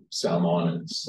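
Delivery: ordinary talk, nothing marked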